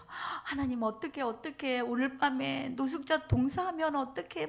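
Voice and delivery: fretful prayer. Retold or imitated. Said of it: "막 이래요".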